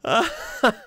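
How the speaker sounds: sudden high-pitched laugh bursting out; burst of high laughter. Real, not acted. Vlogger cracking up at the comment, high laugh.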